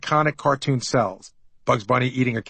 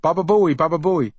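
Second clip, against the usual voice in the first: mild mock yell; 4 semitones higher than usual.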